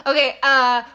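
laughing at own attempt; giggly, embarrassed laugh. Real, not acted. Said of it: "I hate myself".

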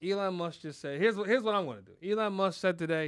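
ordinary delivery

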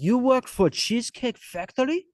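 high, loud put-on voice sliding into a Chinese accent; a mock Chinese accent creeping into the Israeli voice; slipping into Chinese accent. Diner asks the mall cop if he works there, mock Chinese accent. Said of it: "now it went into a Chinese thing".

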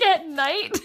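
high, laughing mock answer; mock deadpan mom. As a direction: answer plainly while giggling. Retelling mom's answer.

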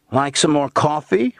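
high, lifted, hospitable question; father's overheard voice. A narrator's reading. Father offering coffee to guests, high-pitched and casual. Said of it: "Becky's father".

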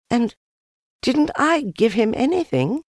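put-on very high, plaintive child's voice; sad Christopher Robin. A narrator's reading. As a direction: ask high and hurt, as a small child. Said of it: "asked Christopher Robin sadly".

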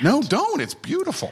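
high eager protest; excited protest. Real, not acted. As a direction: insist warmly and brightly.

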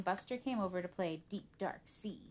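plain reading voice

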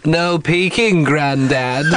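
put-on slow, drawn-out Liverpool accent. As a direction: do a playful northern English, Liverpool-style voice. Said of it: "kind of a Liverpool thing".

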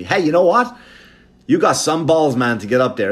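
a loud teasing finance bro; brash Wall Street guys. Retold or imitated. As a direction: rib the comic like smug bros. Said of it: "They go to me".

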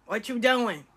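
calling her name across the room; mock call to a child who woke up. Grandma calling out to the grandkid in the tub.

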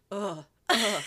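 loud retold ugh of disgust; disgusted groans. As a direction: groan at a stink. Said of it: "you're just like".

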